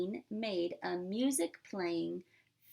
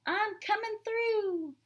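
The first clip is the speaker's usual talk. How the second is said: high, louder playful fish voice; fish's voice; 11 semitones higher, 3 dB louder than usual. Acted. Milton the fish warning the parade crowd, high and playful. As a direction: squeak it out like a tiny fish. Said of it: "said Milton".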